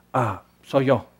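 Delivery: soft, low aside; quiet dawning of recognition; hushed realization. Pretend. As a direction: mutter it as a sudden private realization. Listener's imagined realization voiced.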